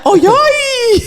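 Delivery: high, loud mock-excited squeal; hammed-up, high-pitched greeting. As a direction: sing out an over-excited hello, very high.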